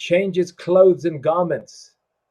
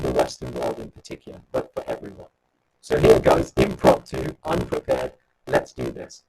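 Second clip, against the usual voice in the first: distorted, crackly line as if the mic is faulty; crackling mic. Real, not acted. Host announcing an impromptu song over a crackling mic. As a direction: voice breaking up through a crackling microphone. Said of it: "Because you are cracking again".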